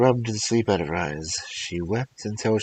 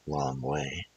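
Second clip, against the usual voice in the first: plain reading voice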